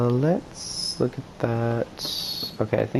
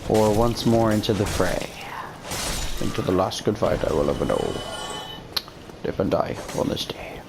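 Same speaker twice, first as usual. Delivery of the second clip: bad Liam Neeson; 3 dB quieter than usual. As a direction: recite it gravely, sinking to a near whisper. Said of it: "that is my worst Liam Neeson impression".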